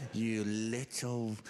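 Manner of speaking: low, slow, held-back fury; mock exasperation. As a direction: draw it out low, swallowing the insult. Dad bites back insult at son.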